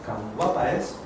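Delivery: quiet, intimate cuán guapa es; soft repetition. Shown as a demonstration. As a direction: let the compliment fade to a hush. Repeats the compliment, fading.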